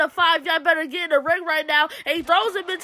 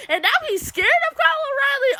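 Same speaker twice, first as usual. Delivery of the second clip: excited; 9 semitones higher, much faster than usual. Real, not acted. Hyped commentary on a reversal.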